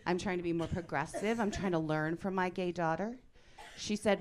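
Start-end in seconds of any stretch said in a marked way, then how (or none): none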